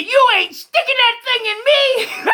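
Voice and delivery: very high, loud and slow, a frantic yell; panicked shout. Acted. Oldest boy dodging grandma's needle, shouting in panic. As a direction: yell it while jumping out of the way.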